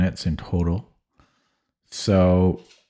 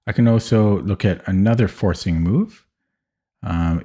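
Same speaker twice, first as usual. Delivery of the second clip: ordinary talk, nothing marked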